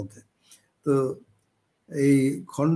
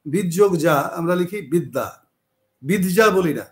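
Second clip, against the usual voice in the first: ordinary talk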